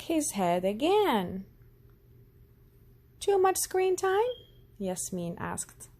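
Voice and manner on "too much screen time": put-on high child voice; little doctor guessing. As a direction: guess like a keen young doctor.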